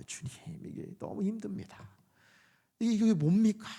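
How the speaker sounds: feeble, struggling cry to the Lord; faint moaning prayer. Acted. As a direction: squeeze out a weak prayer, starting almost in a whisper. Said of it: "이 미약한 신음이 나와요".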